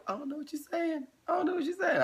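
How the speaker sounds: very high, soft mock woman's voice; high soft confused lady voice; imitating confused woman. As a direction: squeak it out like a baffled stranger. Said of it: "She's like".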